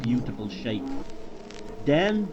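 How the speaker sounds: ordinary delivery, nothing marked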